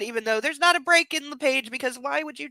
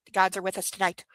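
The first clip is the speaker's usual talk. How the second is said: normal talking voice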